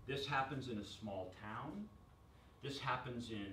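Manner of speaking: usual speaking voice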